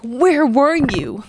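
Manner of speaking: reliving a desperate prayer; crying out to God. Retold or imitated. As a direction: cry the question up to God, slowly. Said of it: "I'm crying out to God".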